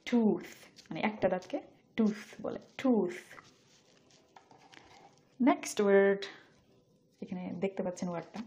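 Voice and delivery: normal talking voice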